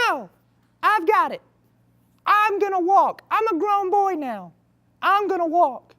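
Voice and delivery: put-on high, loud, proud little kid voice; little boy. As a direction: puff up like a big boy, pitched high. Boy insists on walking alone.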